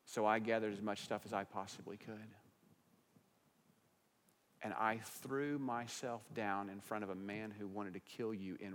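quiet, tender confession; calm wife. Retold or imitated. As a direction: soften it, low and gentle. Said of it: "probably in a very calm manner".

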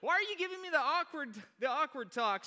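loud, high, hammed-up protest; mock outraged refusal. Youth pastor turning down the sex talk, mock outraged shout. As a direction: yelp it out in playful horror. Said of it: "I'm like".

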